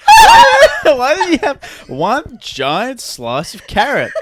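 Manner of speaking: high shout breaking into laughter; laughing mock outrage. Diner shouting about a giant carrot slice, laughing. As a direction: complain while cracking up.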